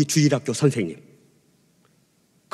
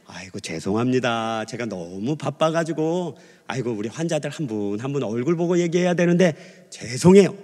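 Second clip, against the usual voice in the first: apologetic doctor; 5 semitones lower than usual. Retold or imitated. 의사가 환자에게 사과하는 흉내.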